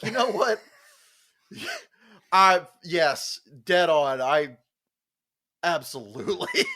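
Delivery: loud, high, laughing yes; cracking up while agreeing; excited agreement. Retold or imitated. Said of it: "And I was like".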